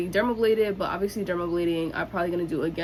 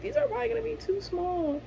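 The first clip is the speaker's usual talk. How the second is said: high-pitched quote; 14 semitones higher, faster than usual. Retold or imitated. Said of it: "and I was like".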